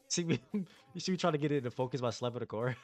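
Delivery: ordinary talk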